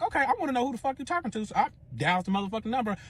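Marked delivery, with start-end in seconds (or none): 0.0-1.5 high, fast retold demand; rushed, higher-pitched indignation; indignant demand. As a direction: act out demanding an answer. Acted demand about the phone call.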